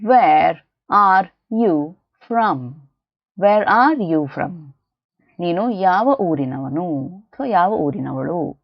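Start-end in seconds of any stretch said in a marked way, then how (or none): 0.0-2.7 very slow, each word set apart; word-by-word model. Shown as a demonstration. Teacher models sentence word by word. 3.4-4.7 slow, connected teaching delivery; measured repeat. Shown as a demonstration. Teacher repeats the sentence more connected.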